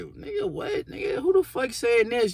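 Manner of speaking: who said that, high and stunned; outraged surprise at a rumor; shocked disbelief. Retold or imitated. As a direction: pitch shoots way up in disbelief. Hearing a rumor about himself.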